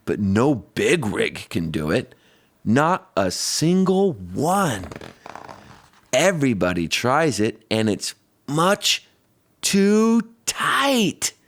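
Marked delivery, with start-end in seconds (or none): none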